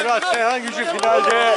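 high excited celebration call. Acted. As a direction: still riding the win. Repeats the result after win.

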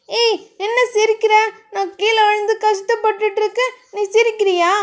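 high-pitched aggrieved whine; indignant complaint. A narrator's reading. Fallen woman scolds the man for laughing, indignant.

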